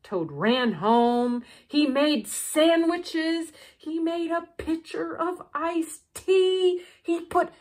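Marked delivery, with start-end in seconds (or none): none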